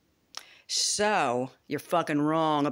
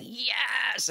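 excited yes; 8 semitones higher than usual. Retold or imitated. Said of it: "And I'm just like".

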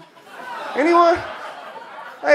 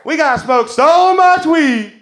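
slow, drawn-out shout to the crowd, higher and louder; rallying shout again; 5 semitones higher, 8 dB louder, slower than usual. Acted. Comic repeats the rally cry to the crowd, slow rousing shout. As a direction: stretch every word like a chant leader.

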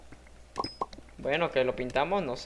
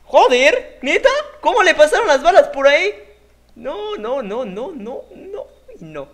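high, loud indignant outcry; outraged disbelief; 12 semitones higher, 13 dB louder than usual. Real, not acted. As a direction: shout in disbelief.

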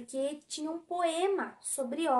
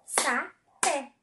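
"Sapé" in two separate beats, Sa - pé; syllable by syllable. Shown as a demonstration. Teacher confirms two syllables, "Sapé" split into Sa, pé. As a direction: pause between the syllables of "Sapé". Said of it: "sapé tem duas sílabas".

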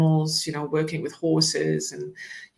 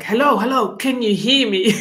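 louder, higher, insistent hello; pushy; 5 semitones higher, 6 dB louder than usual. Retold or imitated. Snake's voice in her head trying to get heard, pushy. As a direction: press them impatiently for an answer, with amusement. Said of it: "it was really pushy".

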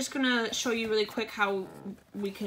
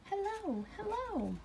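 high, soft queenly greeting; Queen Penguin voice; 10 semitones higher, 6 dB quieter than usual. Acted. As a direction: chirp hello like a tiny queen.

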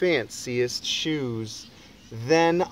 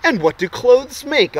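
loud question; 8 semitones higher, 8 dB louder than usual. Acted. Boy quizzes the crowd.

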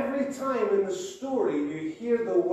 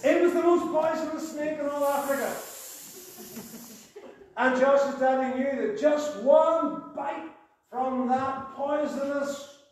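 dramatic storytelling; 8 semitones higher than usual. Acted. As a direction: build the danger for the children. Builds suspense in children's story.

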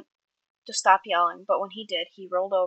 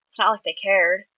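ordinary delivery, nothing marked

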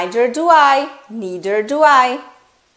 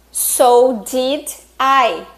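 very slow demo; 2 semitones higher, 4 dB louder, much slower than usual. Shown as a demonstration. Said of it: "Então".

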